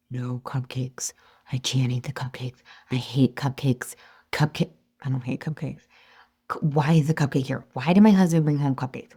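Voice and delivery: low, hushed self-talk under the breath; acted-out whispered resistance; tense inner whisper. Acting out past inner struggle with craving.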